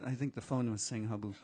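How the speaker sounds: usual speaking voice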